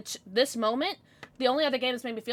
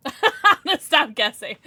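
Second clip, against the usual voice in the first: repeating it through laughter, louder; laughing; 5 semitones higher, 7 dB louder than usual. Real, not acted. Streamer giving up guessing names, laughing. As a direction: give up guessing while cracking up. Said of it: "I'm sorry, I'm sorry".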